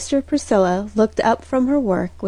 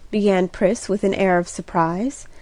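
ordinary delivery

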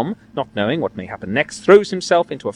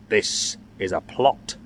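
muttered thought; 5 semitones lower, 6 dB quieter, much slower than usual. A narrator's reading. Tom's inner thought.

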